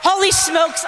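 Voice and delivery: sudden shout of surprise; surprised exclamation. Real, not acted. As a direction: blurt it out, caught off guard. Surprise at time left.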